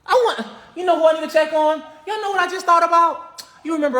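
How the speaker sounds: sudden excited thought. Retold or imitated. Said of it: "been like".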